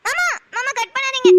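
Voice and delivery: calls out to her uncle not to cut the call, pleading loudly; desperate plea. Acted. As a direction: cry out to stop him hanging up. Begging him not to hang up.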